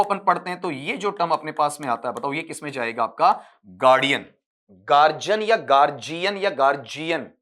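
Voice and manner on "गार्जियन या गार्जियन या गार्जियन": mimicking the common mispronunciation; wrong pronunciation. Shown as a demonstration. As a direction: say गार्जियन with a j, the wrong way students do. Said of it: "ऐसा कोई नहीं बनेगा आपका".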